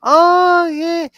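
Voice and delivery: very high, drawn-out parody voice; high-pitched mocking imitation of a tired catchphrase; mocking everyone. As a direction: squeal the opening "oh", then drag out the catchphrase. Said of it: "everyone goes".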